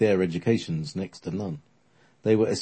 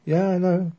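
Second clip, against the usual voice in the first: character voice; 11 semitones higher than usual. Acted. Alfred's deadpan joke reply.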